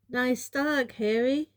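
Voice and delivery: calling out. A narrator's reading. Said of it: "called a tall boy".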